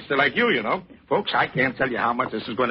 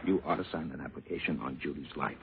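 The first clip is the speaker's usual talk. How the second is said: hushed, lower voice; confidential sales pitch; 11 semitones lower, 14 dB quieter than usual. Acted. Salesman privately urging groom to insure his wife, confidential. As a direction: drop low and confide it.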